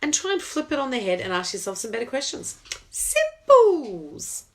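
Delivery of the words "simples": high, loud meerkat "Simples". Acted. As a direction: squeak it like the advert meerkat. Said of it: "Now I sound like a meerkat".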